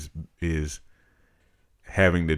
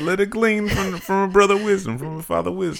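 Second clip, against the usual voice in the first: high, hammed-up wise elder; mock sage voice; 5 dB louder than usual. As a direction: preach it in a silly high voice.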